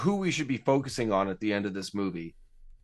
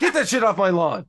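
mock cranky old man; 8 semitones higher, 6 dB louder than usual.

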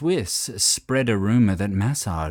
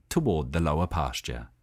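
plain reading voice

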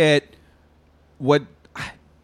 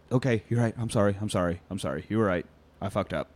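apologies getting softer and lower; fading apology; 6 semitones lower, 7 dB quieter, faster than usual. Acted. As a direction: crumble into quieter and quieter sorries. Speeder breaks down apologizing.

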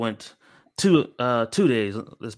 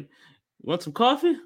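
eager friend; 13 semitones higher, 3 dB louder than usual. Retold or imitated. Friend Derek offering coffee.

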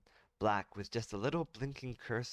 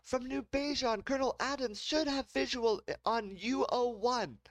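calling across the room; 4 dB louder than usual. Acted.

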